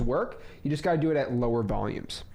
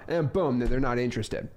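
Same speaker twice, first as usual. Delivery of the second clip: ordinary delivery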